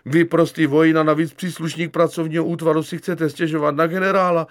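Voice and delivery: high, loud, appalled outburst; outraged disbelief. Acted. Lieutenant hears private will accuse general, appalled shouting. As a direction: exclaim as if he's gone mad.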